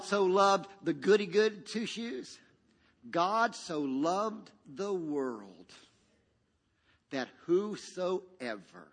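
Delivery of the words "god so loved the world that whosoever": solemn true verse. Shown as a demonstration. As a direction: let each word land slowly. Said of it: "correct verse, slow and solemn".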